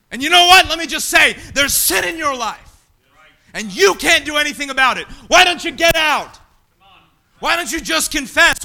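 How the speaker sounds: loud, urgent sermon delivery; forceful preaching. Acted. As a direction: drive the point home to the pews. Preaching to the congregation.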